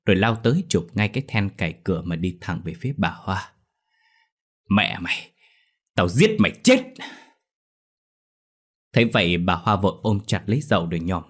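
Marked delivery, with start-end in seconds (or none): 4.7-6.9 angry father erupting; furious threat. A narrator's reading. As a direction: spit out a death threat in rage. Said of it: "Ông Mận giận dữ".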